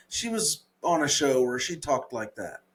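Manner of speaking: ordinary talk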